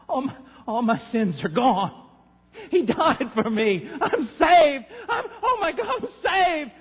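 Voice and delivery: loud, high, laughing and crying; tearful joy. Retold or imitated. Dying rancher realizing he is saved, laughing and crying. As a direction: break into joyful sobs. Old man's conversion cry retold.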